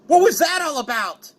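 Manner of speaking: high-pitched acted outrage; indignant outburst, pitched high; angry demand. Joey grabs Kaiba by the collar.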